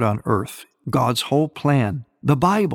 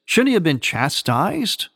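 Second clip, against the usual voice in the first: ordinary delivery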